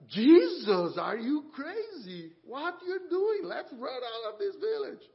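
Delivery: high, loud, alarmed disciples pleading with Jesus; shrill panic, begging to flee the village; panicked disciples. Acted. Disciples urge Jesus to flee Samaria, loud and panicked.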